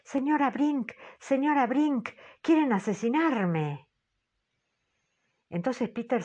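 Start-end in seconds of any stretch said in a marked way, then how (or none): 0.0-3.9 grito agudo y más fuerte, suplicando socorro; grito aterrado. A narrator's reading. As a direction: clama a la señora Brink, aterrada. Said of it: "grita con voz clarísima". (grito de auxilio, agudo y desesperado)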